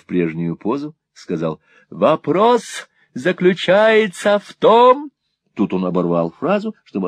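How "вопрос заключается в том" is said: put-on slow, loud, high pompous old man; eccentric old gentleman. A narrator's reading. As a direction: announce it grandly, drawing out each word. Old gentleman voiced in story.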